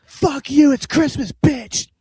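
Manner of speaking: high, loud yelling out the window; retold shout. Yelling at the neighbor from the window, loud taunting shout. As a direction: bellow the insults across the street. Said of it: "ran to the window and said".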